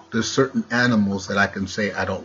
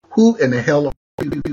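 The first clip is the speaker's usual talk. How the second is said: high, startled demand; indignant challenge; 6 semitones higher, 4 dB louder than usual. Acted. Speaker turns the owl's hoo on listeners, indignant challenge. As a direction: challenge a stranger, pitched up.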